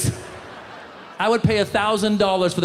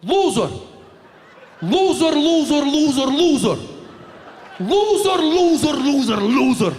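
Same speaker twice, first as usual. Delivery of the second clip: angry uncle; 6 semitones higher than usual. Retold or imitated. Chechen uncle at the press conference: furious repeated yell. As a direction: hurl it at the reporters. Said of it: "And the uncle just".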